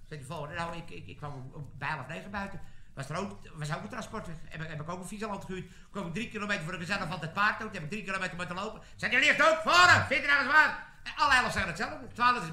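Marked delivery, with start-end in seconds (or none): 0.6-8.8 soft, low, stammered start; sheepish soldier's excuse. Acted. Next soldier stammers same excuse. 9.2-10.9 loud, high military rebuke; major's angry dismissal. Acted. Major rejects the excuse.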